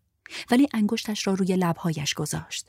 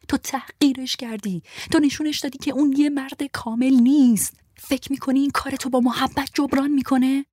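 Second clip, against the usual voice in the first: higher, louder impatient scolding; angry scolding; 7 semitones higher, 4 dB louder than usual. Acted. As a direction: talk to her like a foolish child. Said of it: "صورتش خشمگین بود و انگار که با بچهٔ احمقی".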